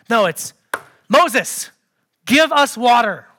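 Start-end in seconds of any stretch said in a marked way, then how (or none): 1.1-3.3 loud, finger-pointing order; angry demand. Acted. Israelites confronting Moses over water, angry demand. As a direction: demand it accusingly, each word punched. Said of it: "I think the finger's pointing and everything".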